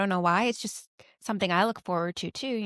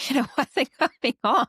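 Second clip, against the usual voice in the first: higher voice breaking into laughter; laughing; 5 semitones higher, 4 dB louder than usual. Real, not acted. Parent recalling gum stuck in hair, laughing. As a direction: tell it through real laughter.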